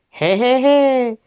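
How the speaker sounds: acted, rising chuckle; a spaced-out "he, he, he" chuckle; laughing. A narrator's reading. Guest laughing at his own joke, acted chuckle. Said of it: "râse".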